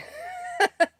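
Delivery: sudden high burst of laughter; real laugh. Real, not acted. Laughs at forgetting to record.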